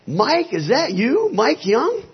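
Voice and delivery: imitating a high, slow, amazed question; astonished recognition. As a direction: stretch it out high, as if amazed to find an old friend. Patient recognizes the pastor in retelling.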